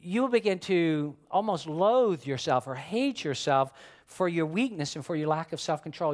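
ordinary talk

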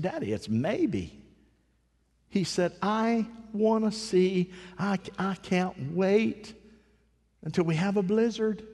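put-on tearful boy. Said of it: "He just went to crying".

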